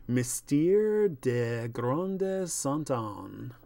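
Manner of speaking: slow, drawn-out French accent; hammed-up French accent, very slow; mock-excellent French accent. Shown as a demonstration. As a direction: read it out grandly in French. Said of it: "That's my excellent French accent".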